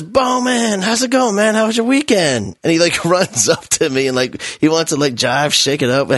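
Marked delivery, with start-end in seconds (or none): 0.0-2.6 imitating a carefree student, voice jumping high; high-pitched, breezy buddy hello; cheery kid greeting. Kid running up to greet his teacher after the weekend, cheerily oblivious.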